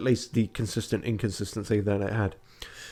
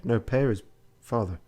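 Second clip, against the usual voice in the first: ordinary talk, nothing marked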